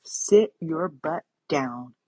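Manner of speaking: a stern, no-nonsense talking-to; firm self-command. Retold or imitated. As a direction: tell yourself firmly to stop and sit. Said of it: "it was like".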